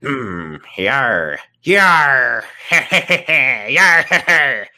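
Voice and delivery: hammed-up pirate yar; pirate growl. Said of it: "I have to do Ben Pirate voice".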